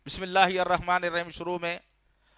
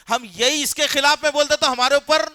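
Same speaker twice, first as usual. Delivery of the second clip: indignant outburst; 7 semitones higher, 7 dB louder than usual. Real, not acted. Preacher protests being branded.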